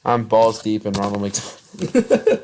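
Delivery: loud, slow, mock-proud declaration; hammed-up boast. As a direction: brag it out like a joke. Jokey follow-up boast.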